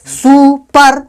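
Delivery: Super stretched as सु–पर, each part held; teacher sounding out super slowly; syllable-by-syllable stress. Shown as a demonstration. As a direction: draw out super in two stressed beats. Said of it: "कहाँ कहाँ stress दे रहे हैं".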